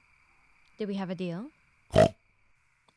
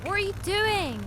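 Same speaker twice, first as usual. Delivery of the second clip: high, loud startled question; alarmed cry; 8 semitones higher, 6 dB louder than usual. Acted. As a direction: cry out as your mount panics. Thrown off by frightened pig.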